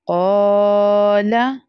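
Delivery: क़ाला with the क़ा drawn long and ला short; stretched first syllable. Shown as a demonstration. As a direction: hold the क़ा, clip the ला. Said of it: "So we have to stretch first part". (क़ाला में क़ा को खींचो, ला छोटा)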